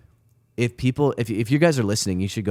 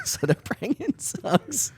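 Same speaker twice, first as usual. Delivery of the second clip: laughing; slower than usual. Real, not acted. Laughs while telling the story.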